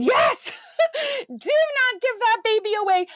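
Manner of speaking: blurting yes before anyone else can claim her; eager. Retold or imitated. Mother to adoption worker on the phone, begging with a high excited laugh.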